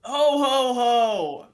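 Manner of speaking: slow, booming Santa laugh; big hearty ho ho ho. Shown as a demonstration. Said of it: "the floor is yours, sir".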